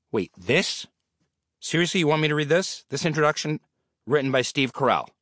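rising outraged disbelief; can't believe he has to read it; indignant disbelief. Acted. Indignant at reading Carell's intro.

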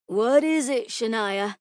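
put-upon teacher answering a pupil; exasperated sigh. A narrator's reading. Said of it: "Mister Bernard sighed".

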